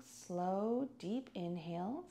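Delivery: normal talking voice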